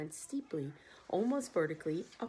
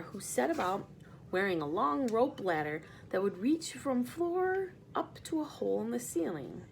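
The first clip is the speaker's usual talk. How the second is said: plain reading voice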